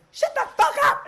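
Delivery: hammed-up, high-pitched yell; furious mock shout. Concubine silencing her maid before slapping her, hammed-up furious shout. Retold outburst before a slap.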